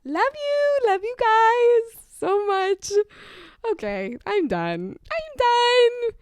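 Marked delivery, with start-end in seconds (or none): 0.0-2.9 very high, loud, drawn-out squeal; squealed sign-off. Pretend. Hammed-up loving sign-off. 5.1-6.1 very high, loud, sustained squeal; high-pitched squeal. Pretend. Same words repeated as a squeal.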